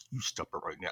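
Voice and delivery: imitated parent's hushed command; low, quiet warning. Parent telling a child to stop, quiet warning. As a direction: drop low and very soft to warn a child.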